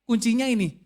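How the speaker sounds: loud, emphatic sermon climax; fervent preaching; preaching at full force. Acted. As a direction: drive the point home to the congregation.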